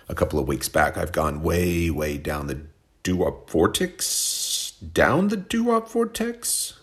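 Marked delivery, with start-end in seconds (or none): 4.9-6.7 much higher, slow squeaky question; mock falsetto echo. Host teasing his own phrase in a slow squeaky falsetto. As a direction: squeak it back at yourself in disbelief.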